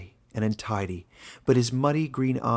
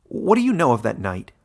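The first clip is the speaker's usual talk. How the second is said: short, sharp and louder; curt; 8 dB louder than usual. Acted. Caleb confronting the warden about his marking night, curt and louder. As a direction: cut him off, clipped and hard. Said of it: "said Caleb shortly".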